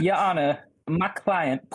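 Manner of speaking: lawyer chicken. Retold or imitated. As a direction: play the blustery chicken lawyer. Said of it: "that lawyer chicken from Futurama".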